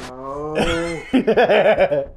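laughing. Real, not acted.

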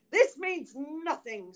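stern dismissal. A narrator's reading. Bluebeard dismisses his weeping wife, stern refusal. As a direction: brush the tears aside coldly.

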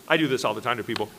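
ordinary talk

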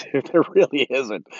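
amused, laugh-tinged aside. Real, not acted. Speaker undercutting his own point on America, laughing. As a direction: let a laugh into the words.

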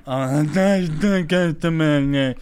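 loud, mock drunk whining; drunken protest. Drunk friend answering from back seat, loud imitated protest. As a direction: protest like a helpless drunk passenger.

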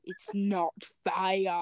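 slow dramatic. Acted. Dramatic reading of fic.

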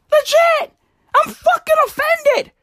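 high indignant squeal; mock offended. Mock outrage at French.

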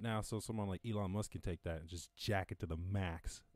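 ordinary talk, nothing marked

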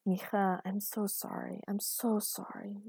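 hushed, remorseful murmur; soft, slowed words of regret; tender apology. Acted. Father apologizing to upset daughter.

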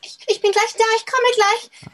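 gerufen aus dem Nebenzimmer; from another room. Pretend. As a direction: wie von weit weg rufen. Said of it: "da hör mal was". (aus einem anderen Raum)

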